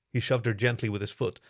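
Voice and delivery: plain reading voice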